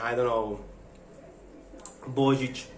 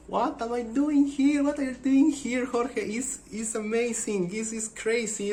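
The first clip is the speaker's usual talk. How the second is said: retold amazement; 10 semitones higher than usual. Retold amazed inner talk.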